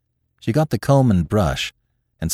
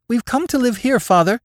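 put-on much higher, boyish and bold; a small boy standing his ground; brave boy's voice. A narrator's reading. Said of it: "His shrill voice quavered out bravely".

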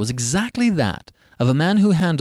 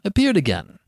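ordinary delivery, nothing marked

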